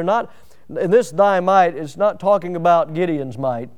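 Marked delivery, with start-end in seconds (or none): 0.0-1.7 slow, with this leaned on hard; slow down and press this; emphasis on this. Shown as a demonstration. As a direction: stress on this, slow and firm. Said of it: "Now here's an emphasis here".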